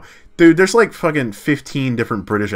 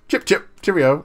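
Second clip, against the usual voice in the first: chirpy cliché British, pitched up; high British accent; 9 semitones higher than usual. Shown as a demonstration. Streamer showing chat that high accent, caricature British. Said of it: "that's that accent, right?".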